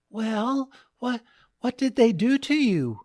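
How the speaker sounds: high, hesitant wavering wife. A narrator's reading. Wife nervously asks husband what they did to him, high wavering voice. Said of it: "with a wavering voice".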